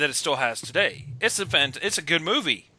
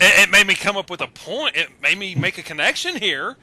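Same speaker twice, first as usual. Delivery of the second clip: excited; 6 semitones higher, 9 dB louder than usual. Real, not acted. Defending his sudden connection.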